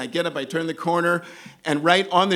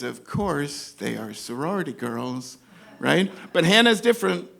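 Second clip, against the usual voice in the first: usual speaking voice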